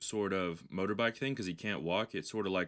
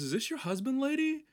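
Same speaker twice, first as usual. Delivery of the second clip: very high, rising incredulous jab; mock indignant. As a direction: sneer it up high. Rider confronts the wife.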